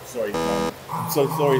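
normal talking voice

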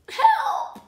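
high, loud croak; croaked cry for help. A narrator's reading. Injured snake croaking for help on the log. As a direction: call for help, strained. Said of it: "he croaked".

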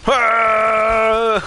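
high, loud drawn-out scream; sustained high yell. Real, not acted. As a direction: scream out loud mid-game. Startled scream during gameplay.

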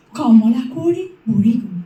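verse line recited low, falling at the end; low recitation. Acted. Reciter first telling of the princess grown old, low. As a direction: keep Kamala Konwari down low.

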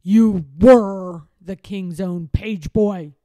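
loud, slow, low put-down with weight on were; snapped. A narrator's reading. Lord Droon dismissing the page boy, snapping. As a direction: cut the boy down coldly. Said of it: "snapped Lord Droon".

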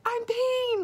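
very high-pitched mock scream; retold scream. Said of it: "like, screamed it".

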